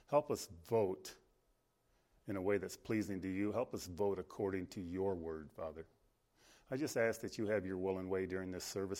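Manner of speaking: soft, low congregational prayer. Real, not acted. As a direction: pray quietly and earnestly to the Father. Opens in prayer.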